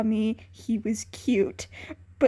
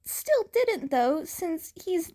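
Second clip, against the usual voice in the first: put-on young boy voice; 8 semitones higher than usual. A narrator's reading.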